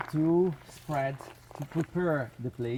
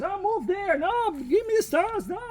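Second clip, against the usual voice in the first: much higher, mock-fussy voice giving orders; bossy helper; 4 dB louder than usual. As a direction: play a know-it-all barking little orders at the group. Mimicking bossy group members.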